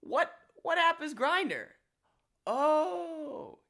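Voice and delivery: a hint of a confused stranger. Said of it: "they said".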